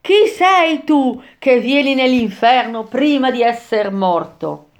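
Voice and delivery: high, put-on spirit's cry; exclaimed challenge. A narrator's reading. Muddy spirit challenging the living traveller, exclaiming. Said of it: "esclamò".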